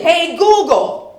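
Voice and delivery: loud, high, drawn-out call; loud command. Acted. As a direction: call out to the smart speaker. Demonstrates commanding the speaker.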